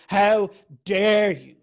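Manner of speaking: loud, slow, indignant how dare you; a stranger's furious accusation; angry woman's outburst. Retold or imitated. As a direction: hurl it at her in outrage. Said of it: "she goes".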